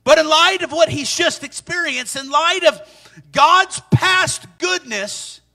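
ordinary delivery

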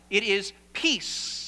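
It is peace, with peace slowed and stretched out; said slowly. Shown as a demonstration. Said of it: "One more time".

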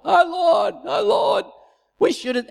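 high wailing cry to the Lord; frightened people crying out to God, high wail. Retold or imitated. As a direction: wail it high and loud like a panicked crowd. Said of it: "they start crying out to the Lord".

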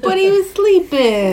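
high-pitched pleading whine; plaintive protest. Real, not acted. Owner objects to friends waking the sleeping dog, high and plaintive. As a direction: plead the poor dog's case.

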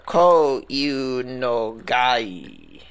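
very slow, each syllable drawn out; slow careful pronunciation. Shown as a demonstration. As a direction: spell out Ko-Iunogai syllable by syllable. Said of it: "pronounce it better".

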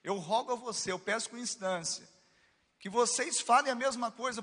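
high, loud, a touch of begging plea; Paul pleading loudly with the church; pleading apostle. Retold or imitated. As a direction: beg the brothers urgently. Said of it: "Tipo assim".

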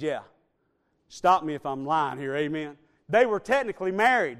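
ordinary talk, nothing marked

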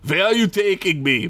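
put-on a light Russian accent vampire question; lightly Russian-accented vampire. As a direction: ask it with a hint of Russian accent. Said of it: "I gotta do a Russian accent now".

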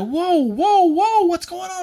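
high-pitched urgent whoa; imitated startled peacemaker; alarmed friend. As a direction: step in to break up a fight. Friend grabbing him to intervene.

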